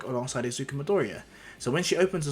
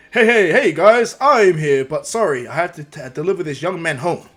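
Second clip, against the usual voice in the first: loud, hearty hero announcing himself; big showman's greeting, raised voice; booming hero greeting; 4 semitones higher, 12 dB louder than usual. Acted. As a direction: boom it out to a crowd, then ease slightly. Hero greets crowd of fans.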